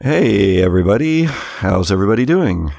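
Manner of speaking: ordinary talk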